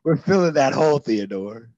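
loud, high shout cracking into laughter; laughing yell. Acted. Corporal shouting at Theodore, breaking up laughing. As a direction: yell it while losing it laughing.

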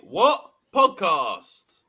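big, slow announcer boom on the show name; drawn-out hammed-up announcer call; announcer intro. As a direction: boom it out like a radio host.

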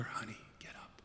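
tender hushed wake-up; sweet whisper. Acted. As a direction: whisper it sweetly to a sleeping child. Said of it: "says sweetly to her".

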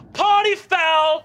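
slow, loud and high outraged cry; drawn-out scandalised shout at the goblin; shouted party foul. Acted. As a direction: bellow it like the party's ruined. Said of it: "and she goes".

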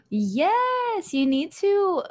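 excited. Real, not acted. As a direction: burst out an excited yes. Excited reaction to the news.

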